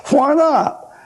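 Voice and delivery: sharp, high surprised question; surprised why not. Retold or imitated. Preacher asking a friend, surprised, high. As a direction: ask it sharply, caught off guard.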